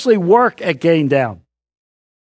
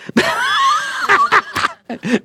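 big laugh. Real, not acted. Preacher laughs at own joke.

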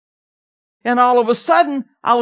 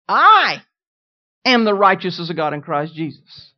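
a big lone "I", pause, lower finish; stress on I; 3 semitones lower, 4 dB louder than usual. Retold or imitated. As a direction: punch "I", then drop down for the rest. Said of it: "Emphasizing every single word".

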